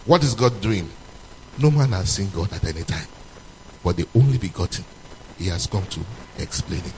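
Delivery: low, soft, hushed preaching; reverent preaching. Acted. Preacher turning quiet and reverent about grace, low hushed. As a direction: bring them into awe quietly.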